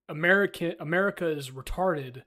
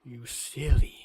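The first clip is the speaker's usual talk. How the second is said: teasing voice; 6 dB louder, slower than usual. Pretend.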